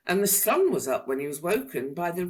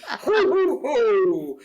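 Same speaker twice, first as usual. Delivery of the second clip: loud, much higher ho ho ho; booming laugh; 8 semitones higher, 9 dB louder, slower than usual. A narrator's reading. Dragon being tickled, booming laughter. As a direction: let the laugh boom out. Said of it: "boomed the dragon".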